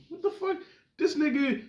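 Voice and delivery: sudden high-pitched disbelief; baffled outburst. Real, not acted. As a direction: squeak out the shock. Baffled by actor's role.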